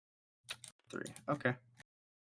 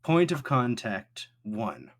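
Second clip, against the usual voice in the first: automated voice; 4 dB louder than usual. Acted. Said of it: "automated voice".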